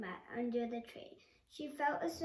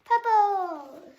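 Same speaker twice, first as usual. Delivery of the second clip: excited shout; 9 semitones higher, 19 dB louder than usual. Real, not acted. Child calling out the last rainbow colour, excited shout. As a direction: shout the colour out loud and high.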